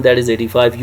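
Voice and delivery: ordinary talk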